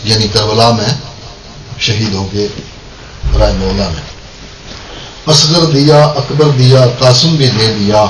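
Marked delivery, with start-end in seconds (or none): 5.3-8.0 poem recitation. Acted. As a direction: recite each phrase separately, descending to the refrain. Recites verse in short phrases.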